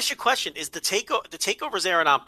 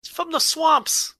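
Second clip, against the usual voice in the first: high-pitched, exasperated correction; mock outrage; 13 semitones higher, 3 dB louder than usual. Co-host correcting where a wrestler is from, mock outraged. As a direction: protest in comic disbelief, voice pitched way up.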